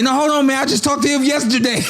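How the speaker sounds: high, laughing impression of an eager friend; imitating Kenny. Friend mimics Kenny eagerly stopping for the shoe shiners, high and laughing. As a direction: pitch it way up and chuckle through it. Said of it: "Kenny, like".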